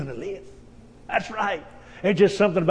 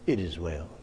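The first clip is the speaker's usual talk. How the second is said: much lower, softer calm reply; quiet assurance; 10 dB quieter than usual. Acted. Mother answering the servant, hushed and calm. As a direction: answer softly in faith.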